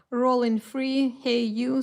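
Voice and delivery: usual speaking voice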